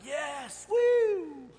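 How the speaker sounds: put-on high, loud little kid's cheer; excited little boy. Son cheering at dad's Netflix promise, a high, loud kid's woo. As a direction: squeal it like a thrilled four-year-old. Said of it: "He's like".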